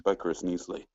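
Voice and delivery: low, soft, breathy-sounding ad read; sultry perfume ad. Acted. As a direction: murmur it like a perfume commercial. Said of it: "dramatic quotes".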